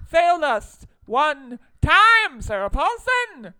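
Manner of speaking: loud, high, drawn-out fan proclamation; emphatic gushing. Real, not acted. Fan praising a favorite actress, loud emphatic gushing. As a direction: proclaim it slowly at full volume.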